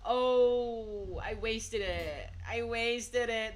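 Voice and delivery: high, drawn-out lament; long, loud moan of regret; dismayed wail. Real, not acted. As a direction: wail slowly over your own blunder. Laments wasting an item in game.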